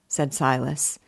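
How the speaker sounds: ordinary delivery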